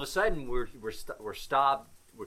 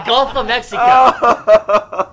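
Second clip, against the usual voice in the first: words breaking into loud laughter, higher and louder; laughing hard; 11 semitones higher, 12 dB louder than usual. Real, not acted. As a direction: laugh your way through the punchline. Laughing retelling hitting killer whale.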